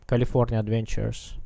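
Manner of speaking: ordinary delivery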